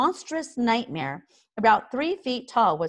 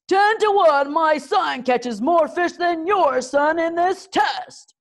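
loud, much higher boastful boom; 10 semitones higher, 7 dB louder, slower than usual. A narrator's reading. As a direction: bellow the bet at your old enemy. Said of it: "boomed Stoic".

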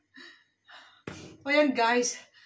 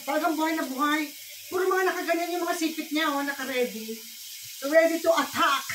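ordinary delivery

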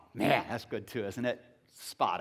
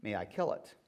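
quieter, lower solemn question; quiet angel; 7 semitones lower, 8 dB quieter than usual. Acted. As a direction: ask it gravely as the angel.

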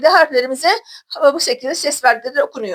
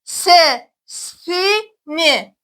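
"Sesini" stretched syllable by syllable, very slowly; much slower than usual. Shown as a demonstration. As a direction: spell out "Sesini" very slowly, each syllable long. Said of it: "Arkadaşlar tekrar okuyalım".

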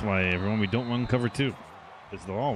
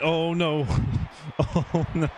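high, loud, laughing protest; laughing dismay; 8 semitones higher, 5 dB louder, slower than usual. Real, not acted. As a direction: crack up while saying it. Laughs at mishap in game.

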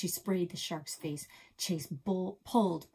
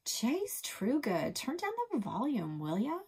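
scolding; 7 semitones higher than usual. A narrator's reading. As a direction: call them to order from the dock. Sister scolding from the dock.

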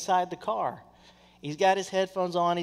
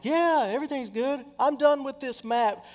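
high, breezy fake-cheerful; mock cheery brush-off; 8 semitones higher than usual. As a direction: brush her off brightly, done with it all. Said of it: "I was this close to being like".

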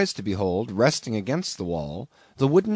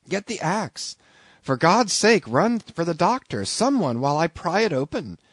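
urgent plea; 6 semitones higher, 3 dB louder than usual. A narrator's reading. Urgent pleading.

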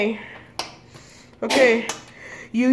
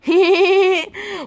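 giggle; 6 semitones higher than usual. Real, not acted. Laughing at the trick.

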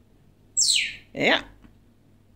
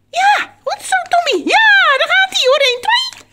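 very high, loud squeals of encouragement; delighted high-pitched praise; excited cheering; 7 dB louder than usual. Real, not acted. Cheering the bird on.

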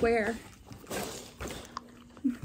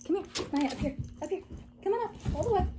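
coaxing dog voice; 7 semitones higher, 6 dB quieter, much faster than usual. Real, not acted. Coaxing Maya up.

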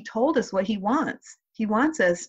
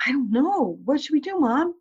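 imitated child, pitched higher; distressed little daughter; 7 semitones higher than usual. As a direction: act a little girl at a loss. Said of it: "she was really distressed".

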